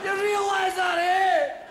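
high, loud Scottish challenge; Scottish accent. Acted. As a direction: holler it as an indignant drunk Scotsman. Drunk Scotsman shouts back.

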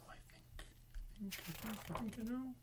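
soft whisper rising toward discovery; hushed thinking. Acted. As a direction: whisper as the idea dawns. Zero's hushed realization.

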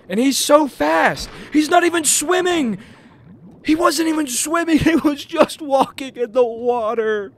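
high-pitched exasperated whine; incredulous wail. Real, not acted. Player outrun by a goblin in water, incredulous wail.

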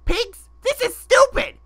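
fast, rising frustrated yell; frustrated shout. Acted. Protesting that everyone chasing him can fly, frustrated shout.

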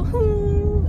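high, drawn-out crying hum; long high whimper, put on; mock crying whine. Shown as a demonstration. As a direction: imitate a squealing crier. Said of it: "if you cry, you go".

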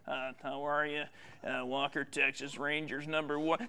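very low, quiet impression; Joe Mack. Showing off an impression to Lisa, deep impersonation. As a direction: drop way down low and murmur it. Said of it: "watch me do my impression of Joe Mack".

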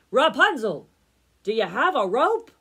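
prince's loud comic call. A narrator's reading. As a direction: holler the question up a tower. Prince shouts up again.

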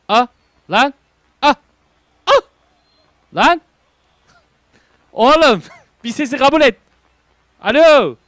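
loud high yelling with a laugh; frustrated shout. Real, not acted. Streamer yelling at the game to register his voice. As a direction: shout in exasperation.

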